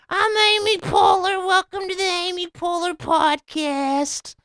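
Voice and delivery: very high, held falsetto; silly squeaky podcast-host introduction; Opie doing Amy Poehler. Retold or imitated. Podcaster copying Opie's Amy Poehler impression, ridiculous high falsetto. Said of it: "He kept doing that voice".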